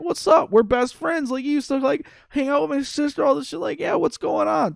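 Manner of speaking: high-pitched, mock pleading friend; game character pressing. Acting out Ralphie pressing his best friend, high mock insistence.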